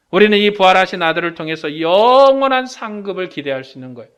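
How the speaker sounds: ordinary talk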